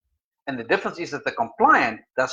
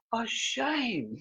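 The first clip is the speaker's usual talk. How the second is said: soft, high-pitched cooing; caring sympathy; 7 semitones higher, 10 dB quieter than usual. Acted. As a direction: coo softly over someone who is hurt. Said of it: "the caring thing will come out".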